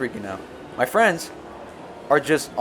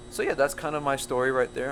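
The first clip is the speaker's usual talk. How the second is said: normal talking voice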